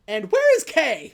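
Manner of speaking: acted-out yelling for an answer; retold yell. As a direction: raise the voice into an exasperated question. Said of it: "What are you yelling about?".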